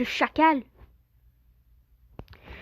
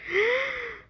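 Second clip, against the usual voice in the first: sharp gasp; 9 semitones higher than usual. Real, not acted. Sudden danger in game.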